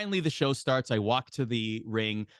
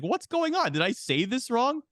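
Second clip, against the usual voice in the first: confused; 10 semitones higher than usual. Retold or imitated. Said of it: "very confused at this point now".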